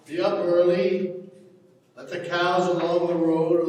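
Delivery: loud, commanding farm orders. Acted. Father orders Sally about the cows, loud and commanding. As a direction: bark the chores at her.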